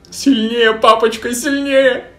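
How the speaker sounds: high pleading voice. Acted. Manager begs Lucifer.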